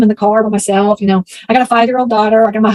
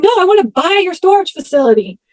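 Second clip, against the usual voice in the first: high, raised answer, a mild mock yell; yelling to be heard; 8 semitones higher than usual. Telling the deaf owner she wants to buy, mild mock yell.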